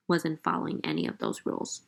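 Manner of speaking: ordinary talk, nothing marked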